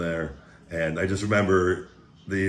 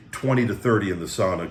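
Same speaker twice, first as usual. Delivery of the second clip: normal talking voice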